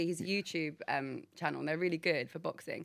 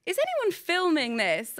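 retold giddy disbelief, higher and louder; mock disbelief; 10 semitones higher, 6 dB louder, slower than usual. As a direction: call it out high and drawn out, as if appealing to witnesses. Retells her stunned reaction to flattery.

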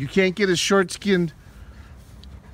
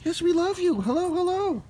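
much higher, cooing puppy voice; 11 semitones higher, 3 dB quieter than usual. Real, not acted. Cooing at the dog.

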